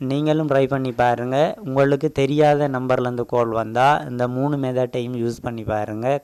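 ordinary talk, nothing marked

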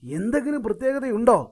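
curious cartoon voice. Curious high animal asks.

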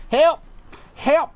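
calling for help. Real, not acted. Player crying for help while getting shot. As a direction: shout for help.

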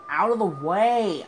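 high, loud shout at traffic; angry yell. Real, not acted. Gamer yelling at traffic, angry shout. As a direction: bark at the cars blocking.

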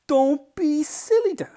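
high, loud, slow scolding; scornful older sibling. Acted. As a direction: stretch out a loud, high put-down.

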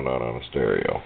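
normal talking voice

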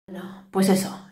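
breathy whispered aside, resigned. Real, not acted. Mother whispering a resigned aside to viewers. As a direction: drop to a whisper, shrugging it off.

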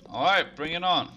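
high loud hype call; hyped demand. Pretend. Streamer asking the game for beats, hyped. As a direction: call for the beat.

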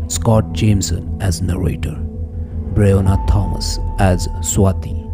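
usual speaking voice

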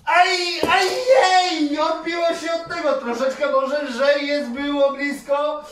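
high, loud yelps then fast excited chatter; excited near-miss. Real, not acted. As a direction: react to the bounce, voice up high. (podekscytowany, wysoki głos po pudle)

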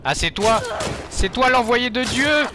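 high, loud mock-reverent exclaim; mock awe. Real, not acted. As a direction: hail the enemy as sent by God. Mock awe at unkillable enemy. (admiration moqueuse et criée)

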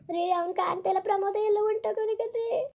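high, tearful plea on the phone; distressed crying. Acted. Wife crying to husband on the phone that their son is gone. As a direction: sob the news to him.

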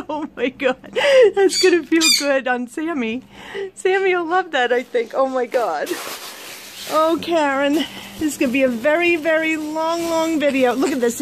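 ordinary talk, nothing marked

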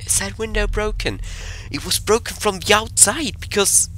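high-pitched, puzzled questioning. Real, not acted. Player baffled by the broken window, puzzled.

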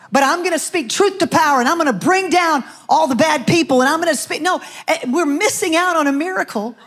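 rising sermon delivery; preaching. Acted. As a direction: preach it to the room with conviction. Preaching to congregation.

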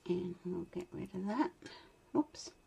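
ordinary talk

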